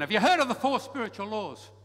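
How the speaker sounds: mock pushy evangelist, loud; blustering evangelist. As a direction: barge in with a canned pitch. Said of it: "we come blustering in".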